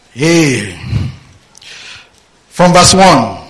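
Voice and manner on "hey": ordinary delivery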